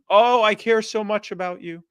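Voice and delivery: high, loud, syrupy politician gush; hammed-up caring-politician voice; mock sympathy. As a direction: parody a fawning politician. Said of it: "instead of going".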